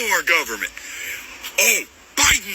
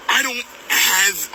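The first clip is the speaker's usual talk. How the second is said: normal talking voice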